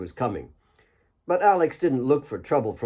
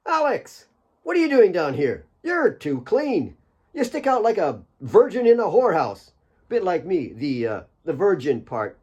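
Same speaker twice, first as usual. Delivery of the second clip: put-on much higher, bouncy teasing voice of a cheeky young miner; bubbly cousin; 7 semitones higher than usual. Cousin Asaf ribbing Alex about being too clean, high bubbly teasing. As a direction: bubble over with cheeky delight at seeing him.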